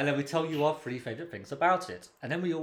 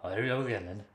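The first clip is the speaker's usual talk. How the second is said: normal talking voice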